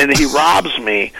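usual speaking voice

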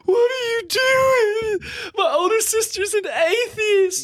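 put-on very high, slow, wailing kid voice; horrified little sisters. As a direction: shriek in mock horror like a scandalized child. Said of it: "me and my little sister being like".

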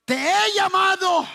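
high, sustained preacher's call; ringing proclamation. Real, not acted. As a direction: call it out as God's declaration. Proclaims God's words. (proclamación exclamada)

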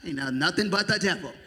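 ordinary talk

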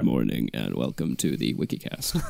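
deep, hammed-up show-host intro; low announcer voice; mock podcast host. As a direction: open the show like a cheesy presenter.

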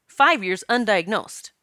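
normal talking voice

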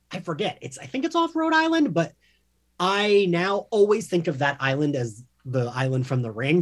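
ordinary delivery

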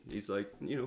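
ordinary delivery

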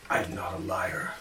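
low, breathless strained whisper; whispered apology. Acted. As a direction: hold back the rage, whispering. Frothing Quaggoth restrains itself.

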